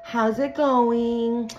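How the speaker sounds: high, slow syrupy fake concern; drawn-out put-on niceness; fake sweet call. Ex calling to check on kids, syrupy fake concern.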